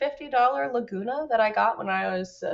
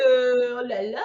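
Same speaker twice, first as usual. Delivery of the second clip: slow hammed-up French oh la la; mock French; 5 dB louder, much slower than usual. Shown as a demonstration. Showing a friend how to do French, slow hammed-up oh la la. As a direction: stretch it out like a cartoon Frenchman. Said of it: "all you have to do is go".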